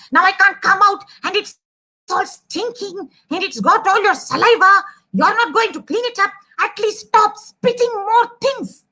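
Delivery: high, nagging mouse voice; exasperated mouse. Acted. Mouse telling the elephant off again, high and exasperated. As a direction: scold on and on in a high pitch.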